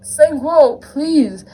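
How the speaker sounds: loud, high pleading; begging. A narrator's reading. Captive to Sangwo in a story, begging. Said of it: "I begged".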